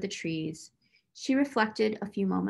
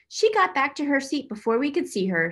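exclaiming; 5 semitones higher, 3 dB louder than usual. A narrator's reading. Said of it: "Sarah exclaimed".

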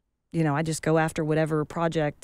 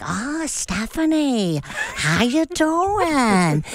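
slow, high Polish aunt voice; Polish accent; 7 semitones higher, much slower than usual. Retold or imitated. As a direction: drawl it like an old Polish aunt. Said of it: "one was like".

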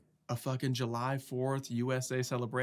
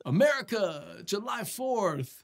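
higher, drawn-out mock cheer; imitating patriotic waiters; 8 semitones higher, slower than usual. Imitating waiters celebrating July fourth, mock patriotic cheer. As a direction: stretch it out like a hammed-up celebration. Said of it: "'cause they're like".

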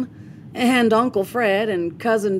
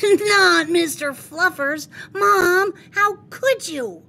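put-on much higher, loud, shocked child protest; horrified outcry; 11 semitones higher, 4 dB louder than usual. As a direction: cry out as if betrayed. Boy defends his beloved bunny.

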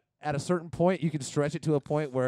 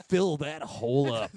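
announcer voice; 4 dB louder than usual. Retold or imitated. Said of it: "I just loved the punch here".

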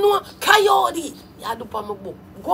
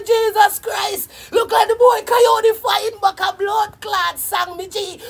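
excited exclaim; 7 semitones higher, 4 dB louder than usual. Retold or imitated. Recalling the dance, shouting in disbelief. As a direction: cry it out in amazement. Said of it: "I say".

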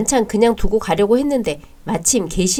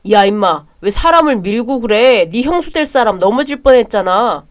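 angry scolding; 6 dB louder than usual. Acted. Brother scolds for pushing fiancée.

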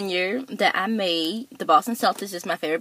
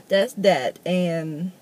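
ordinary talk

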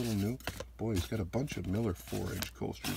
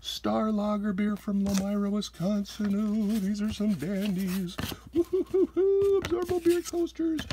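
much higher and louder, squealing over each find; real excitement; 12 semitones higher, 3 dB louder than usual. Real, not acted. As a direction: gush with collector's glee, whooping. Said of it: "I'm getting too excited by looking at 'em".

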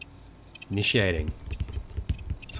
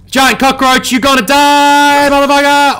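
shouting; 12 dB louder than usual. Real, not acted. Yelling at a giant cockroach.

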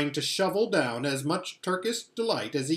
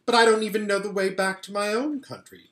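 pleading; 6 semitones higher, 5 dB louder, faster than usual. Acted. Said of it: "pleaded Edmund".

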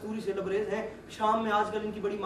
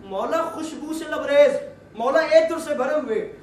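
loud, higher pleading, each line starting with Maula; impassioned plea; 5 semitones higher, 8 dB louder than usual. Acted. Merchant pleading with the imam, loud and fervent. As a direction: beg the master with rising fervor.

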